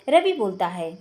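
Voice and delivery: usual speaking voice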